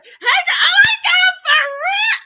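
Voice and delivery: high, loud, laughing squeal to her cousin; girlfriend chat. Retold or imitated. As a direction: gush like catching up with a cousin. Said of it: "I'm talking to my cousin".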